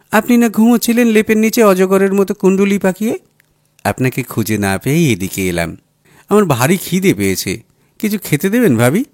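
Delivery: a lightly voiced surprised young wife; startled teasing. A narrator's reading. Sayma startled, then Shovan.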